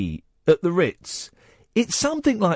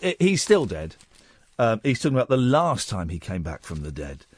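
ordinary delivery

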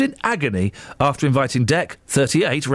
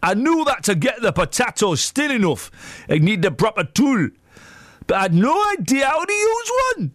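put-on Geordie accent; 6 semitones higher than usual. Radio host performing Ant's kitchen mishap quote, hammed-up Geordie. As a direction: act out the quote in a bad, high Geordie voice. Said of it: "That's my Geordie accent".

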